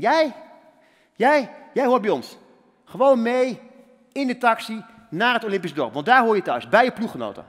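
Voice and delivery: rally him like a fired-up coach; emphatic coach. Retold or imitated. American coach insists the injured skater rejoins team, loud and firm. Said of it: "die zei tegen mij".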